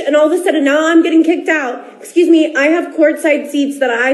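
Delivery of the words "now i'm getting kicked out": ordinary delivery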